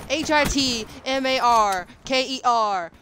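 each letter H I T M A R K E R said apart; spelled out. Real, not acted. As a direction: spell hit marker letter by letter. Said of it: "spelling it out".

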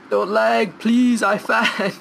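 high, slow pleading whine; hammed-up plea; mock pleading. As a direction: beg the game not to lag. Pleading with the lagging game.

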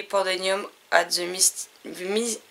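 English title in a strong French accent. Real, not acted. Vlogger reading a card's English title aloud, French-accented English. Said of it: "mon super accent anglais qui s'améliore pas".

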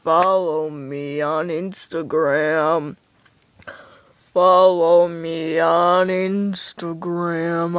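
low, slow, growling; deep beast voice. Acted. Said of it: "I'm Beast".

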